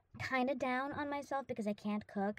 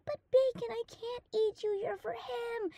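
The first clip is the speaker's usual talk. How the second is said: pleading with the bacon; 11 semitones higher than usual. Retold or imitated. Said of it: "And I was like".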